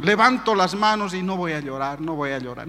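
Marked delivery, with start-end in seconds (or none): 1.2-2.7 soft, low self-talk, retold; muttered resolve. Man telling himself in church not to cry, softly muttered. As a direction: quietly talk yourself out of crying. Repeats resolve while fighting tears.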